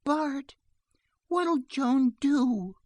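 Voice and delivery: put-on high, drawn-out child's appeal; dismayed little girl. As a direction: appeal helplessly as a small child. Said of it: "turning in dismay".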